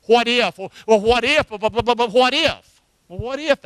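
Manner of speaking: nervous mock hand-wringing; anxious worrier. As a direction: play someone spiralling into worry. Acting out anxious what-if worrying.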